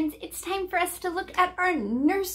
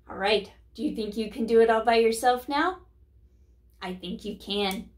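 ordinary talk

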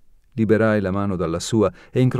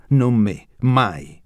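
louder, biting refusal; furious; 3 semitones higher, 4 dB louder, much slower than usual. A narrator's reading. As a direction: spit out the refusal with anger. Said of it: "rispose con furia".

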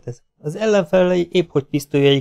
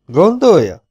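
high, raised, taunting question; mocking question; 6 semitones higher, 5 dB louder than usual. A narrator's reading. Bandit taunts Logan over his doubts, mocking. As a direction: lift it sharply into a sneering query. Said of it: "Felelte gúnyosan Holdvilág Teddy".

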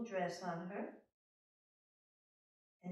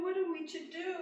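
dismayed queen; 11 semitones higher, 3 dB louder than usual. Acted. Queen despairs.

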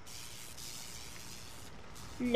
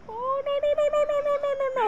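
high, long wail of no; mock panic wail; 12 semitones higher, faster than usual. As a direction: wail in panic as you die.

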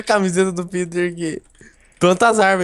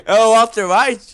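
laughing wail; 4 semitones higher, 6 dB louder, slower than usual. Real, not acted. Laughing mock wail at drawing.

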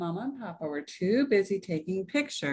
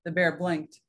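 usual speaking voice

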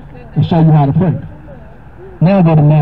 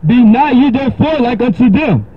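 raised, solemn King James reading; scripture reading; 6 semitones higher than usual. Acted. Preacher reading Matthew six to a caller, solemn. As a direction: read it like holy writ.

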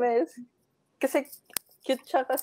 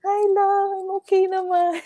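higher, louder sugary imitation; mock sweet reply; 7 semitones higher, 5 dB louder than usual. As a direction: play it sickly sweet, teasing. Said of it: "parang maano ka rin".

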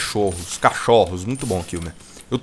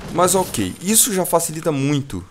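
ordinary delivery

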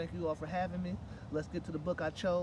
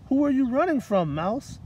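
higher, louder friendly animal voice; curious panda; 9 semitones higher, 14 dB louder than usual. Acted. Panda asks the mouse who chases her, high and bright. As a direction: ask it brightly, like a kindly panda. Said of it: "asked Panda".

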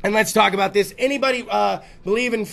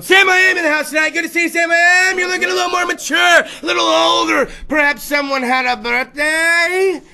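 announcer greeting; 6 semitones higher than usual. Acted. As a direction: greet Sam like a game-show host. Host greeting cohost.

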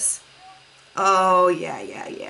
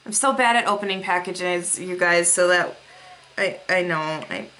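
ordinary talk

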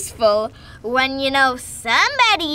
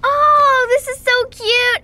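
high, loud gushing delight; 9 semitones higher, 5 dB louder than usual. Real, not acted. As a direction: squeal over how cute it is. Gushing over a cute toy.

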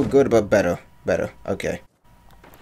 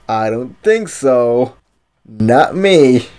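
mock outrage; 5 semitones higher, 4 dB louder, much slower than usual. Real, not acted. Outwitted opponent, mock indignation.

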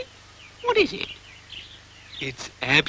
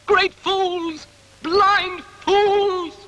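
angry outburst; 6 dB louder, much slower than usual. Acted. Dog rants about the others.

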